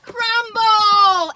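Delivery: bright, loud cry of the shop's name; joyful reveal of the name; excited shout. Real, not acted. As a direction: announce it with a thrill. Showing off the Crumbl shop.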